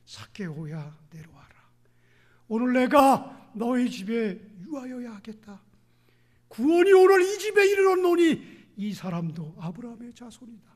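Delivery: voicing Jesus. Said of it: "예수님이 보셨습니다".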